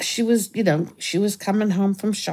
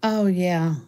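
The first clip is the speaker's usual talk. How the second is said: normal talking voice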